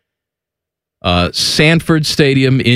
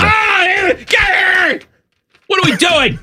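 excited shout; slower than usual. Real, not acted. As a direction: yell it in disbelief. Outburst at a pizza opinion.

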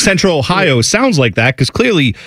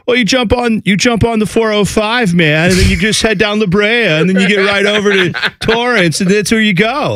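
put-on Californian accent; 6 semitones higher than usual. Host imitating a comedy sketch, exaggerated Californian accent. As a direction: ham up a Californian giving freeway directions. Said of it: "a sketch ... called The Californians".

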